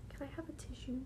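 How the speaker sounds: quick unvoiced whispered aside. Real, not acted. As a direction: whisper the request off-mic. Asking for tissue mid-recording.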